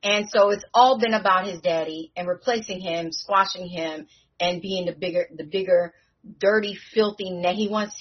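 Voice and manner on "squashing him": ordinary talk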